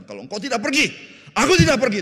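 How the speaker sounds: loud, high desperate plea; pleading Moses. Acted. Preacher voices Moses begging God to come along, loud high plea. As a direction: beg God not to leave. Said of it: "Musa bilang".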